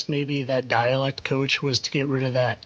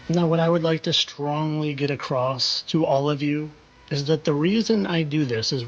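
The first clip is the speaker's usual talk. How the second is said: usual speaking voice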